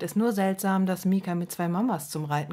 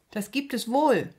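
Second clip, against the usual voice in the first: higher, raised protest on the verge of tears; child's defiant cry, raised; upset child's call; 6 semitones higher than usual. A narrator's reading. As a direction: insist hotly, fighting back tears. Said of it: "ruft Mika mit kribbelnder Nase".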